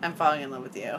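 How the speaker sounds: mock intimate. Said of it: "it's like a five-step".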